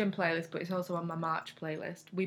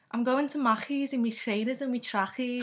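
broad Scouse, pitched up; thick Scouse accent; 5 semitones higher, 3 dB louder than usual. Shown as a demonstration. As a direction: lay the Liverpool accent on thick. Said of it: "That's a thick one".

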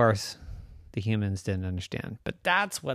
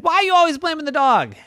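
fast, high, loud protest; indignant scolding; 8 dB louder, faster than usual. Acted. Rose scolding mommy over the dog, indignant. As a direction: leap to the dog's defence.

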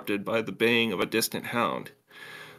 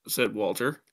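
ordinary delivery

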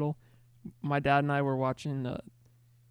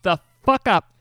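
loud snap; 6 semitones higher, 11 dB louder, slower than usual. Real, not acted. Friend snaps at another.

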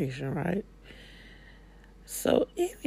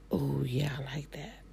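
whispered aside; 2 semitones lower, 7 dB quieter than usual. Acted. Inner voice enjoying the work.

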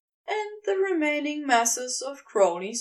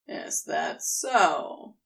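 slow, lower serpent mockery; mocking; 7 semitones lower, much slower than usual. A narrator's reading. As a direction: savour the taunt slowly. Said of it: "Crowley mocks him".

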